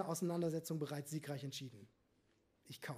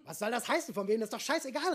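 higher, louder indignant outcry; exclaimed; 4 semitones higher, 5 dB louder than usual. A narrator's reading. Steffi arguing with Marco over dinner, an exasperated outcry. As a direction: throw the question back at him, exasperated. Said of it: "rief Steffi".

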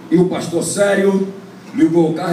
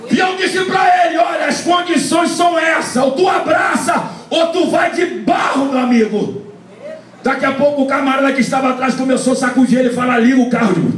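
preaching at full force; 12 semitones higher than usual. Acted. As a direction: shout the story out to the congregation like a revival. Preaching climax, calls the crowd.

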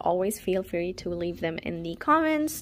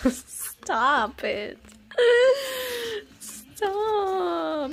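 whiny fake sob, drawn out; hammed-up whimpering; mock crying plea; 11 semitones higher, much slower than usual. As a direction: beg it to stop, rising to a high wail. Mock begging as duplicates pile up.

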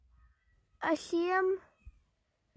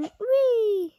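excited whee; 6 semitones higher, 6 dB louder than usual. Real, not acted. Sliding down.

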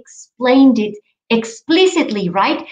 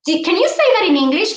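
teacher prompt; 7 semitones higher, much faster than usual. Retold or imitated. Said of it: "ask children".

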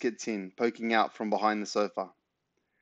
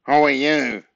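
loud, higher nasal demand; nasal bark; 4 semitones higher, 9 dB louder, slower than usual. A narrator's reading. Nosy neighbour challenges boy at the door, nasal bark. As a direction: bark it through your nose. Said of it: "he barked nasally".

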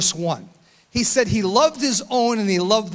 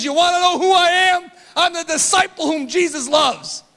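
loud, high-pitched full-force sermon; shouting pulpit preaching; fiery preaching; 9 semitones higher, 7 dB louder than usual. Acted. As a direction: preach it out hard to the congregation.

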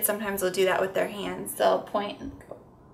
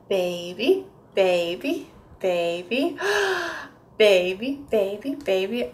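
put-on rising sing-song baby with a gasp; building repeated baby; slower than usual. Shown as a demonstration. Therapist chanting baby for a toddler. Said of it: "kind of like with the ducks".

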